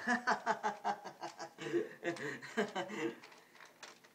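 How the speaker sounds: high, loud scornful laughter; mocking laugh. Ruler laughs at equality for women, high mocking laughter. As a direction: laugh down at the idea. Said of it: "the ruler starts laughing".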